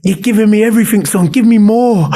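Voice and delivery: hammed-up training-ground cry; coach urging. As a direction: push the player like a coach. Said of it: "he'd be like".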